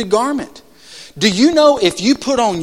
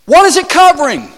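"What is it covering?" asked again high and loud, hitting "What"; loud pleading question to the congregation; louder repeated question; 8 semitones higher, 5 dB louder than usual. Real, not acted. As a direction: call the crowd to answer. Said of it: "Y'all help me out".

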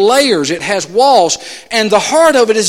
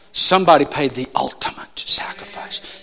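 solemn whisper; 5 semitones lower, 3 dB quieter than usual. Real, not acted. Solemn point about sacrifice.

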